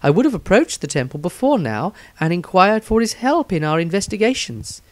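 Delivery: character diary voice. Acted. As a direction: read it as her own eager diary entry.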